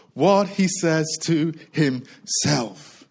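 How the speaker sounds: half-speed, word by word; slow emphasis. Real, not acted. Preacher on the only thing that changes, slow and deliberate. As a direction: space out the words to drive it home. Key phrase spaced out for emphasis.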